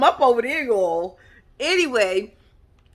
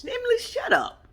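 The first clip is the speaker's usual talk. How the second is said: sassy scolding; 14 semitones higher than usual. Retold or imitated. Scolding Emily while recapping show.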